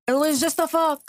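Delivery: high mock yell of an excuse; imitated yell. Chiming in with the cheating husband's excuse, mock yell. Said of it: "he yelled out".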